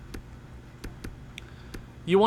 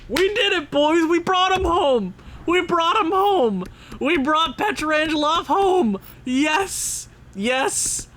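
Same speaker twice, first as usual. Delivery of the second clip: triumphant cheer; 10 semitones higher, slower than usual. Real, not acted. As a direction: shout it like you just won the trade. Celebrating completed trade.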